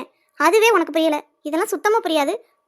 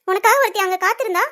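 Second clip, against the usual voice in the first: ordinary delivery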